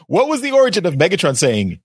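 ordinary delivery

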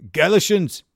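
"galishins" tried in a hammed-up Scottish voice; Scottish accent attempt. Shown as a demonstration. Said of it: "I can't do a Scottish accent. I'll try".

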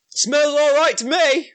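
high-pitched ogre voice; put-on ogre, pitched right up; unconcerned ogre. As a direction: answer as a dim ogre. Ogre answers poison warning.

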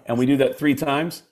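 usual speaking voice

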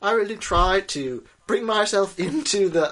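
high, faltering mock British accent; badly faked British accent, starting to slip; put-on British accent. Co-host explaining himself to John, British accent failing. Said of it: "develop a strong British accent".

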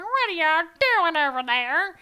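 grumpy country dog talking; silly dumb pet voice. Retold or imitated. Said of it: "I have a voice for my dog".

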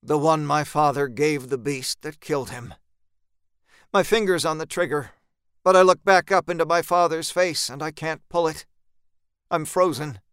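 plain reading voice

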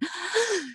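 a sharp, high gasp for air; demo gasp. Shown as a demonstration. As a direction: gasp like coming up for air after sobbing. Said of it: "and you catch a breath".

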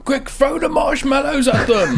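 high, loud laughing alarm; mock urgent shout. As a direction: yell it like a frantic ally. Urgent joke cry.

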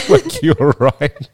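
giggling through the words; laughing. Real, not acted. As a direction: break into laughter. Laughing mid-sentence.

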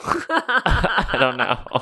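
slow words through laughter; laughing. Real, not acted. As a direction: laugh it out, drawn out. Laughing about the gross omelet.

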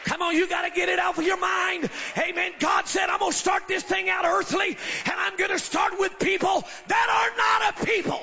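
loud, high, shouted preaching. Real, not acted. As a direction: preach it at full force. Preaching at full force.

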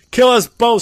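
high, very loud desperate plea; desperate cry. A narrator's reading. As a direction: cry out as a storyteller voicing a desperate bride. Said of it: "she cried desperately".

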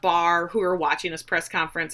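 usual speaking voice

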